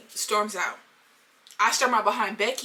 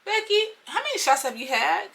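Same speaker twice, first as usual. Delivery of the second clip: high-pitched disbelief; mock-incredulous, pitched up; incredulous reply; 7 semitones higher than usual. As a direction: question her like she's had too many. Acted incredulous reply to Becky.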